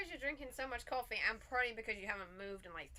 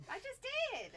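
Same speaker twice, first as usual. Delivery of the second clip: louder; 6 dB louder, slower than usual. Real, not acted. Asked to speak louder.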